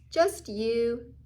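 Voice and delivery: plain reading voice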